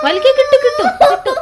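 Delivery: eager urging. Real, not acted. Mother cheering daughter to pull the tooth, excited and laughing. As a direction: cheer her on, half laughing.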